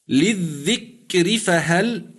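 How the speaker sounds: للذكر فهل drawn out very slowly with a thin ر; Quranic recitation. Shown as a demonstration. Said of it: "الراء مكسورة ولكن ما قبلها ساكن".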